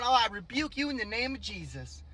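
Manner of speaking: soft, quick, offhand mock rebuke; imitating her kidding around; nonchalant imitation. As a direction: toss it off like a joke. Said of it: "She said it so nonchalantly, like, just kidding around".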